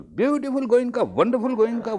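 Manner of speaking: high, crooning self-praise; comically smitten with his own name; mock self-admiration. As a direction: gush over yourself in a high pitch.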